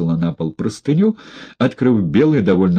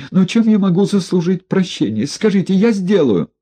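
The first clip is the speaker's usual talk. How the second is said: high, eager begging; pleading man; 11 semitones higher than usual. A narrator's reading. Одутловатый заискивающе просит Егорова о прощении.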